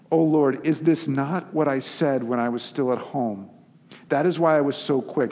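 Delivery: slow, rising Bible reading that turns into Jonah's complaint; dramatic scripture reading. Acted. Preacher reading Jonah's prayer to the congregation, dramatic reading.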